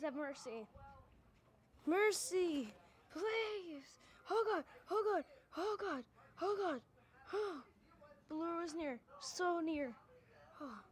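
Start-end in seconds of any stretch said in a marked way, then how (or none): 1.9-7.6 high, loud panicked pleading; terrified horror victim. Real, not acted. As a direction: beg in real terror. Monster close, begging for mercy.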